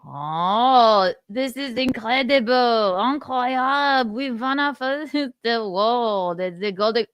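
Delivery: gushing fake French acceptance speech; mock French accent. French winner accepting a Golden Globe, mock French accent. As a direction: play a thrilled winner with hammed-up French. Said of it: "How do you do a French accent?".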